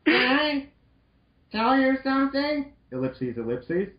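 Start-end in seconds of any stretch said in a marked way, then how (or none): 0.1-2.7 put-on higher, bright girl-robot voice; cheerful Roll voice. Roll greeting Mal cheerfully in a higher voice. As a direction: play sweet Roll greeting her friend. Roll's voice.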